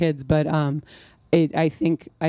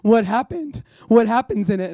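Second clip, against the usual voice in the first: high, loud incredulous sister; mock sister; 5 semitones higher, 3 dB louder than usual. Sister asks what happens in the story, mock disbelief. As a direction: imitate a sister's tearful disbelief. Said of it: "she's like".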